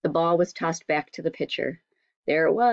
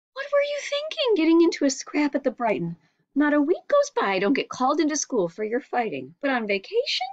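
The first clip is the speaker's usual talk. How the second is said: much higher, exasperated mother voice; scolding mother; 11 semitones higher than usual. A narrator's reading. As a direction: nag him about the fights at school. Mother scolds son's fighting.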